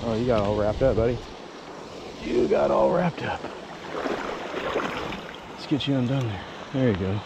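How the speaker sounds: ordinary talk, nothing marked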